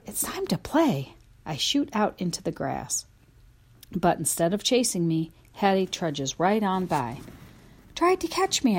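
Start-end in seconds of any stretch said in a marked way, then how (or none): 0.0-1.1 playful whisper. Acted. Dog narrator whispers playfully. 7.9-8.8 high, playful bark of a line; excited high dog voice. A narrator's reading. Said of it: "I bark".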